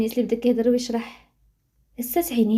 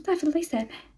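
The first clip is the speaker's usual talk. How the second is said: ordinary delivery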